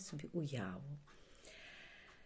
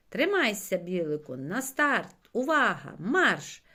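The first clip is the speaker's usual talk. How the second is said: loud starter's call; race command; 3 semitones higher, 11 dB louder than usual. A narrator's reading. Чорник starts the race for Білик, commanding call. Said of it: "дав команду чорник".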